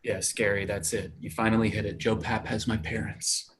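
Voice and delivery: low, soft mock confession; deadpan sarcasm. Actor humours worried friend with absurd answer, deadpan sarcasm. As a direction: play it dry and understated, well under the voice.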